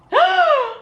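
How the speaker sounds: loud, high gasp; sharp startled gasp; shocked gasp. Retold or imitated. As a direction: gasp in shock at being walked in on. Retelling door flung open.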